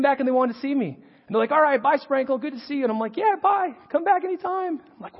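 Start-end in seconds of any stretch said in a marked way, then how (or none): 1.5-2.8 high, sing-out student goodbye; acted-out friendly farewell, pitched up; imitating departing students. 3.2-4.8 very high-pitched, eager farewell; acted-out gushing send-off, pitched way up; delighted goodbye. Flattered teacher waves students off.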